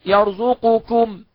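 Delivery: يرزقكم drawn out, qaf said; slow, careful يرزقكم; original form. Shown as a demonstration. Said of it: "هي الكلمة أصلها إيه؟". (نطق الأصل ببطء)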